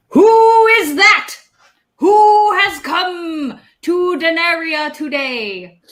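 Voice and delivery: a booming stranger demanding who's there; mysterious voice. Acted. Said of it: "you hear a voice from the back of the barn".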